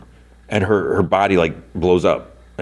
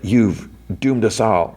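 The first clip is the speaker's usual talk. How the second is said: slightly higher, slow and dramatic witch-wizard voice; mock doom pronouncement; 4 semitones higher, slower than usual. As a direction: lightly act out a slow cry of doom.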